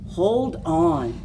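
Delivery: usual speaking voice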